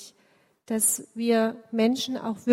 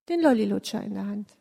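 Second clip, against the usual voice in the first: ordinary delivery, nothing marked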